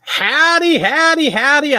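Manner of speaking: high, slow, drawn-out holler of howdy. Real, not acted. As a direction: stretch each howdy out loud like a cowboy greeting. Opening a live show greeting.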